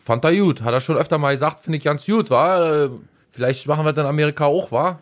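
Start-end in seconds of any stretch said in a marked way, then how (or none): 1.6-5.0 put-on high, fast Berlin twang with American accent; Berlin dialect. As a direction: imitate Trump in Berlin dialect. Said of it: "Sagt der so hoch auf Berlinerisch".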